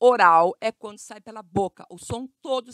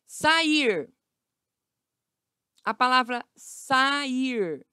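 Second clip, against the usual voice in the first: "sair" slowly as sa-ir; separated vowels; 2 semitones higher, much slower than usual. Shown as a demonstration. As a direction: stretch "sair", parting a from i. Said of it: "ficam em sílabas separadas".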